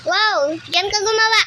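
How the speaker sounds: loud, exclaiming call to a teammate; loud exclamation. Real, not acted. Player telling teammate where to build, loud exclamation.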